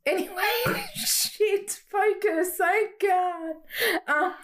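high giggly voice; squeaky laughing words. Real, not acted. Giggly self-correction after accent slip.

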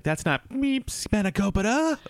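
loud high-pitched mock rant with a squeaked bleep; mock bleeped insult. Cook imagining a censored TV chef tirade, high shouted mock bleep. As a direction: squeal the bleep, then shout the nonsense word. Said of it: "You just hear, like".

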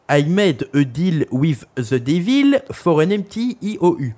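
English read slowly with a heavy French accent; mock fine English accent. Shown as a demonstration. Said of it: "je vous le lis avec un très bel accent".

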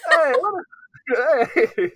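high, loud mock Italian American; hammed-up Italian-American heckle; Italian American shouting. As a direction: holler it as a cartoon Italian American. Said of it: "Italian American".

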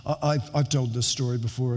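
ordinary delivery

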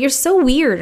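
mock teen friend; friends' reaction. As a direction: tease like a baffled teenage friend. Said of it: "they were like".

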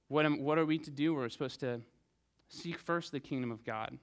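ordinary talk, nothing marked

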